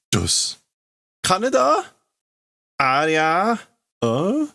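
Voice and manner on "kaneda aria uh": very high, loud stunned calls; dazed high voice. Acted. Punched character calls out dazed, very high voice. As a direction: sound knocked silly.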